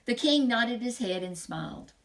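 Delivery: usual speaking voice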